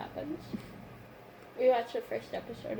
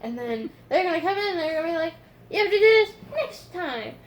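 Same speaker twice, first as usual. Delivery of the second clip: panicked; 7 semitones higher, 9 dB louder than usual. Retold or imitated. Retelling panic before procedure.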